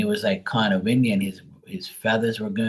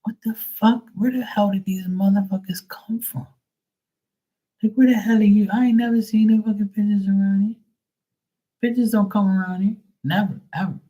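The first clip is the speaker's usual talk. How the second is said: much higher, quicker, hammed-up disbelief; astonished outburst; 12 semitones higher, 4 dB louder, faster than usual. Retelling to viewers his shock at the pigeons, much higher, hammed-up disbelief. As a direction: replay the shock, pitched right up. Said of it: "And I'm like".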